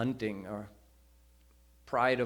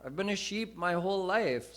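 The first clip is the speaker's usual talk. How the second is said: put-on confused young lion; 6 semitones higher, faster than usual. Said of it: "the younger lion said".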